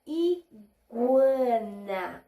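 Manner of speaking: "Iguana" drawn out slowly, i-gua-na with gw; slow syllables. Shown as a demonstration. Teacher reading to children, slow and syllable by syllable. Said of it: "Conseguimos ouvir o som das três sílabas".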